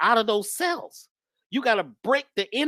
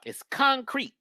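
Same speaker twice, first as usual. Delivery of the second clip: ordinary delivery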